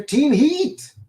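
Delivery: high, loud call-out; excited greeting. Real, not acted. Host greets Team Heat joining the chat, excited. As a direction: hail a regular as they arrive.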